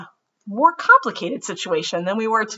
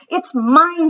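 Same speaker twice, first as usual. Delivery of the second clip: put-on louder, higher childish yell; 10 semitones higher, 8 dB louder than usual. Ruthie yells at Martin in front of the teacher. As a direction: yell it stubbornly. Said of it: "she yelled".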